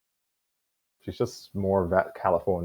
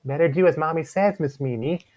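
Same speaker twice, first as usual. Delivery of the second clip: higher, smirking taunt; 8 semitones higher than usual. A narrator's reading. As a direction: tease her like a smug sibling. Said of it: "Nyx taunted Joe with a smirk".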